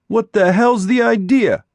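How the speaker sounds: high, loud demand on "hell's"; angry shout. Acted. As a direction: yell "What the hell's the idea?" in outrage. Boy confronts intruder.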